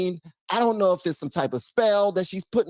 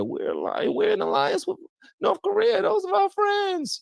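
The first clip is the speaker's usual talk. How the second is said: high, far-off mocking imitation, North Korea pitched way up; mock Harris. To viewers, mimicking Harris's gaffe in a high mock voice. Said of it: "She said North Korea".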